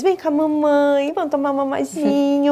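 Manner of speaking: put-on doting mother's baby voice; demonstrated baby talk. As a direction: coo it to a baby, stretching the vowels. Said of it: "com uma voz mais aguda".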